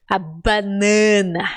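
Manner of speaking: "banana" slow with heavily nasal a's; exaggerated nasal a. Shown as a demonstration. Teacher exaggerates Brazilian nasal a. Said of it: "Eu tô exagerando".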